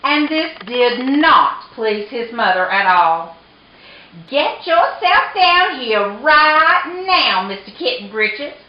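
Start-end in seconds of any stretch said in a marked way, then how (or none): 4.3-8.5 scolding mama cat. A narrator's reading. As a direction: order the kitten about like a cross mother. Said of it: "ordered Mama Junebug Jones".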